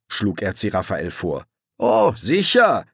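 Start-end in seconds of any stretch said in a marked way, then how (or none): 1.8-2.9 high, drawn-out mock assent; sarcastic agreement. A narrator's reading. Crowley scorns the idea of asking someone, sarcastic. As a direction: scoff at the idea with exaggerated agreement. Crowley mocks the passenger's suggestion.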